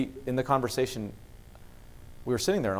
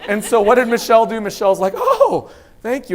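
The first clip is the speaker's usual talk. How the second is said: much higher, louder, laughing; laughing delight; 10 semitones higher, 12 dB louder than usual. Retold or imitated. Wife thanking daughter for cookies, high laughing delight. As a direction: squeal of delight.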